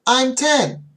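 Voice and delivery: put-on high, loud little kid reply; a hint of a small child answering brightly; mock child answer. Teacher imitating a young student's wrong answer, high and loud. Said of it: "they'll often say".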